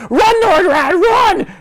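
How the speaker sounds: high, loud acted shout; urgent warning. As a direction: cry out an alarm in character. Acts the character's urgent warning.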